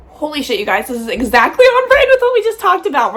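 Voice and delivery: high excited amazement; amazed. Real, not acted. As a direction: sound truly stunned. Stunned by card pull.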